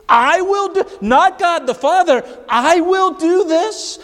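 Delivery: high, driving preacher's voice, pressing each phrase home; full-force preaching. Acted. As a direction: preach it hard to the congregation.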